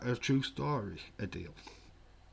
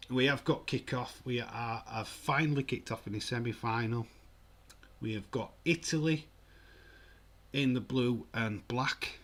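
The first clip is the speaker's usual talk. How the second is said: live play-by-play; 2 semitones higher, 3 dB louder than usual. Pretend. Streamer announcing the semi-final kick-off, play-by-play delivery. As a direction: call the kick-off like a commentator.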